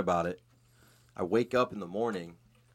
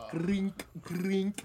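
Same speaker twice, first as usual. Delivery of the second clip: high squeaky mock noise, twice over; imitated noise; 9 semitones higher than usual. Imagining mom after taking acid, high imitated noise. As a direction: make a high little imitation sound.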